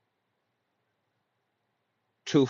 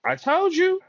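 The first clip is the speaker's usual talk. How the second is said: excited squeal; 6 dB louder than usual. Real, not acted. Excited squeal after the session.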